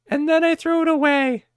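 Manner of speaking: high sister voice, tossed off; mocking sister. Vlogger mimicking sister throwing it away, high mock voice. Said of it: "And I said".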